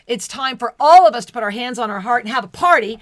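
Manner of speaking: loud, fast, rising to a party cheer; excited call. Real, not acted. Host rallying the livestream audience, loud excited call. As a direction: whip the room up for a party. Calls everyone to celebrate.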